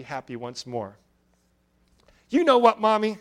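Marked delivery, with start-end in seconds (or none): 2.3-3.2 put-on high, loud eager child's voice; excited announcement. A narrator's reading. Little girl announces her idea to her mother, high excited child voice. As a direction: announce it like a girl bursting with news. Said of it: "she announced excitedly".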